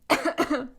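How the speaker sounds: loud real coughing fit; coughing after choking on a word; sudden choking cough. Real, not acted. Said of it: "And now I'm choking".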